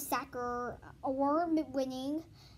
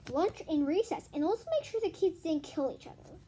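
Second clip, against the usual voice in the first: reading a chapter aloud, louder and lively; animated read-aloud voice; story narration; 8 dB louder than usual. Acted. Reads chapter aloud to viewers.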